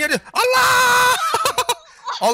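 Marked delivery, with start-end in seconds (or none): 0.3-1.1 high frightened shout; panicked scream. Real, not acted. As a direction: yelp in sudden panic. Caught by heads in game.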